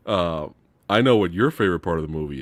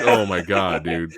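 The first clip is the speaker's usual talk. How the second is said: ordinary talk, nothing marked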